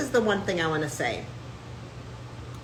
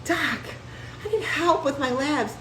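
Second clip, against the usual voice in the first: whiny follower; 7 semitones higher, 4 dB quieter than usual. Retold or imitated. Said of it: "who are like".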